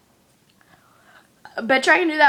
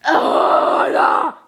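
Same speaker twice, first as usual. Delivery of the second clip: ordinary delivery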